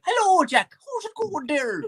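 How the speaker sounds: high, slow caricature of an American; mock American accent. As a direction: imitate a Brit doing American, high-pitched. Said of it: "the Brits imitate our accents".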